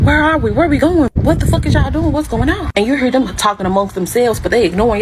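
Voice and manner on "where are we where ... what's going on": frantic questions. Retold or imitated. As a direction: demand answers in alarm. Waking up in kidnappers' car.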